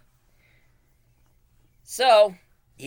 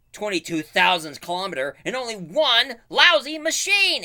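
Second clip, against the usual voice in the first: higher, louder outraged hero; exasperated Buzz; 8 semitones higher, 7 dB louder than usual. Retold or imitated. Buzz finding one washing machine, loud and outraged. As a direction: bellow the complaint in disbelief. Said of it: "Buzz was like".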